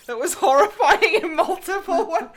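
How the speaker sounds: laughing loudly while talking; cracking up mid-sentence. Real, not acted. Laughing after anime voice.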